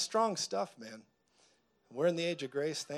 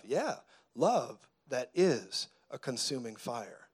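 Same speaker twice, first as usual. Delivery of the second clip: slow emphatic; slower than usual. Acted. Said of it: "repeats refrain slowly".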